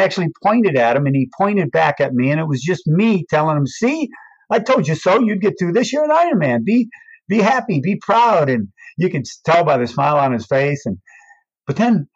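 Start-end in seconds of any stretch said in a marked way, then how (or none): 3.7-8.5 cheering him on. Retold or imitated. Telling him he's an Iron Man.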